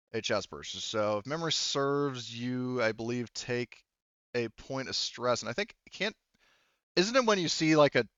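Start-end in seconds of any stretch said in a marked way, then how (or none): none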